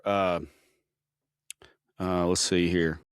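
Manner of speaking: normal talking voice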